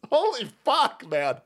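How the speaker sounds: normal talking voice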